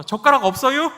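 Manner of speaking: high, loud, sly Chungcheong question; 충청도 말투로 슬쩍; Chungcheong indirect talk. Retold or imitated. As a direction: slip the point in last, Chungcheong style. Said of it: "이렇게 얘기하는 게 충청도 화법입니다".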